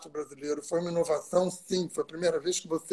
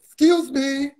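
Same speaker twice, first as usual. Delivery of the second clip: English "Excuse me" said higher and louder; imitating the director's English; 7 semitones higher, 6 dB louder, slower than usual. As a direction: mimic the American director's correct "Excuse me". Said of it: "você tem que dizer assim". (imitando o inglês do diretor)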